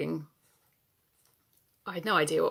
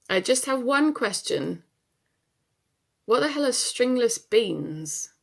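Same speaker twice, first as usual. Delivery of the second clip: exasperated question; 6 semitones higher, 5 dB louder than usual. Acted. Baffled question to Mum on phone.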